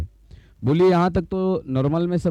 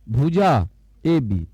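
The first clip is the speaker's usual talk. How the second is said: slow reading aloud; much slower than usual. Shown as a demonstration. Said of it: "क्या पढ़ेंगे?".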